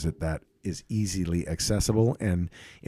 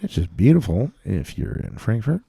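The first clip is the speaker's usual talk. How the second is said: louder captain speaking voice; over the intercom; 4 dB louder than usual. Acted. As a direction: pilot announcing the flight over the cabin speakers. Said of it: "you have a great captain speaking voice".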